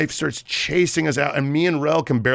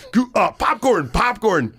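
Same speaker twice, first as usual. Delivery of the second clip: panicked; 9 semitones higher, 3 dB louder than usual. Retold or imitated. Frantic safe word to bodyguard.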